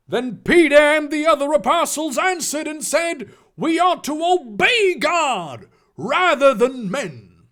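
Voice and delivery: high, booming fire-and-brimstone reading; preacher voice. Pretend. Reader whipping out Ephesians for a congregation, preaching. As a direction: thunder the verse from the pulpit. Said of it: "preacher voice".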